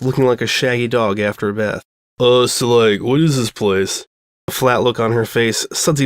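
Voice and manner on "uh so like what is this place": slow, dopey surfer drawl; surfer dude voice. Acted. Quarterback asking about the laundromat, slow dopey surfer voice. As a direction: play the laid-back jock, slow.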